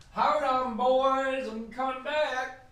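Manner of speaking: distant, high drawn-out call; calling from away. Real, not acted. Host to listeners while stepping away, distant high call.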